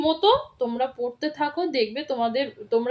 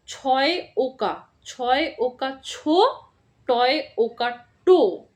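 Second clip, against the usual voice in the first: slow spelling out; slower than usual. Shown as a demonstration. Teacher spelling out a word slowly for first graders. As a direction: spell each letter slowly and clearly. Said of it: "ভেঙে ভেঙে বানান করে করে পড়বে".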